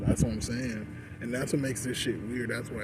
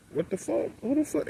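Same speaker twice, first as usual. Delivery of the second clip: high-pitched retold bewilderment; baffled reaction; 11 semitones higher than usual. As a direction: act out disbelief, pitch jumping up. Retelling baffled reaction to stares.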